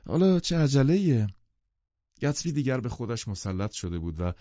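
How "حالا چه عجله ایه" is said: high, loud protest from an eager host; raised pitch, urging them not to rush; insisting. A narrator's reading. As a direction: press the guests to stay. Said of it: "گتسبی اصرار کرد".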